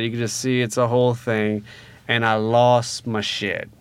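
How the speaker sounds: ordinary talk, nothing marked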